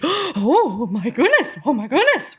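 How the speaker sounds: hammed-up horrified reaction; mock shock. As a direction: play up being appalled. Acting out shock at a remark.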